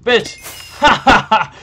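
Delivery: loud, high yell; real shout. Real, not acted. Player taunting an opponent in game, shouting.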